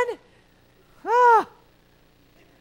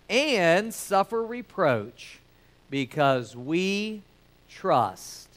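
slow, low, soft scripture reading; 7 semitones lower, 4 dB quieter, slower than usual. Acted. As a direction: read the verse with reverence, slowly. Reads scripture slowly, reverently.

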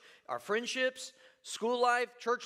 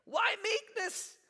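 high slow question; 12 semitones higher, slower than usual. Acted.

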